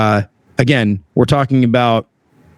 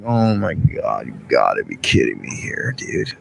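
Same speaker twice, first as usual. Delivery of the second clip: muttered disbelief; 6 dB quieter than usual. Retold or imitated. Embarrassed technician to himself, hushed low disbelief. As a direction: let it sink down under your breath.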